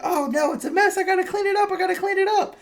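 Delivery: very high, frantic robot-nanny voice, rising to a shriek; panicked animatronic babysitter. Retold or imitated. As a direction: fuss in a squeaky panic over the mess. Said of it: "He's like".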